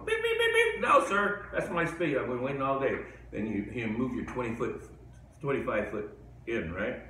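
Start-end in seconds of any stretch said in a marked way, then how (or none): 0.0-0.8 high squeaky beeping horn; imitated car horn. A new owner honking at the driver, high beeping horn. 0.8-3.0 indignant driver. Retold or imitated. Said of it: "new owner goes".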